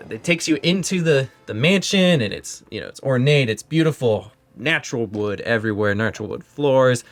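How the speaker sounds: ordinary delivery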